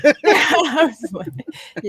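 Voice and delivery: laughing through the words; giggling while speaking. Real, not acted.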